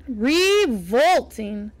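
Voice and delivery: "Revolting" langsam und dröhnend gedehnt; rumbling revolting. Acted. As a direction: drag out "Revolting" slow and booming. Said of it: "The single word rumbled down".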